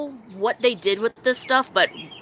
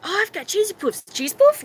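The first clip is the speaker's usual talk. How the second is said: retold stoned offer; 8 semitones higher than usual. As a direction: imitate your high self offering a snack. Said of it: "And I was like".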